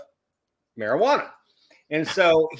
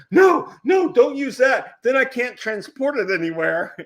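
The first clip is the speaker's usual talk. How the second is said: loud, high mock-urgent objection; alarmed protest; 7 semitones higher, 5 dB louder than usual. Costumer stopping a friend from using his prop, alarmed protest. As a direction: yell it out to stop someone in a hurry.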